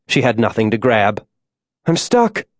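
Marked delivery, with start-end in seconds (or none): 1.8-2.5 high-pitched, contained cry for help; yelled. A narrator's reading. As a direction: call down in alarm, pitch way up. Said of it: "she yelled".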